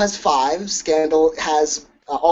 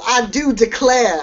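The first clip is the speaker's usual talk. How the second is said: slow, higher mock Southern belle accent; 7 semitones higher than usual. Critic teasing the actors' accents, in a mock Southern accent. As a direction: ham up a genteel Southern accent, slowly and high. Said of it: "both of their Southern accents felt like a little".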